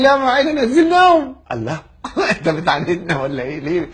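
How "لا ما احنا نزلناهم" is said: high-pitched, loud dismissive reply; exaggerated clerk's retort; mock airline clerk. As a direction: throw it back high and loud. Airline man retold in quarrel.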